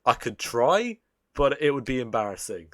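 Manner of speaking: ordinary talk, nothing marked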